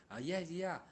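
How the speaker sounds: normal talking voice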